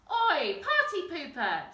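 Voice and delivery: loud, high sharp snap; little troll snaps loudly; snapping Grouch. A narrator's reading. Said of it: "snapped the Grouch".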